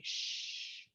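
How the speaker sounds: a whispered, very soft "Shh"; soothing shush. Shown as a demonstration. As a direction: hush her gently like calming someone. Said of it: "another character say".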